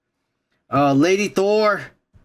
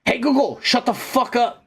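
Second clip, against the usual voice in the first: shaking with anger; 3 semitones higher than usual. Real, not acted. Ranting at Google voice assistant.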